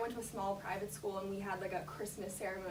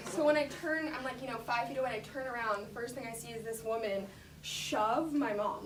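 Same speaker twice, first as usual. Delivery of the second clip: usual speaking voice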